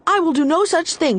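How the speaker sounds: high, fast defiant snap; snapped. A narrator's reading. Irene defying the wind, high fast snap. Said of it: "she snapped".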